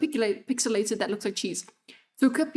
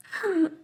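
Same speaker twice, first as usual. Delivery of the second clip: startled laugh; 8 semitones higher than usual. Real, not acted. Jump scared by running enemy.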